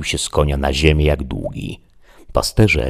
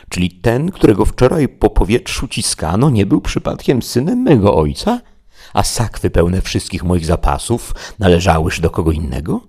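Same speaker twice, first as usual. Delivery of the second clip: higher, sarcastic servant; Sancho the squire; 5 semitones higher, 3 dB louder than usual. Acted. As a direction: retort with wounded irony. Sancho retorts bitterly.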